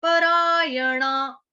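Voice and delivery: परायणा slowly with ण as in बाण, tongue curled; retroflex na. Shown as a demonstration. Teacher showing ण as in बाण. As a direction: stretch परायणा, curl the ण. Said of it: "अण बाण वाला".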